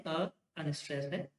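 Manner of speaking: ordinary talk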